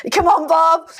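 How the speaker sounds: cheering. Retold or imitated. Colleague cheering Bob at the board, loud and excited. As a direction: pump your fist for Bob. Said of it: "He's like".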